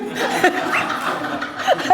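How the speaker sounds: high breathy giggle; high laugh. Real, not acted. Clinging to his feet, a high giggle. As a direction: giggle at the memory.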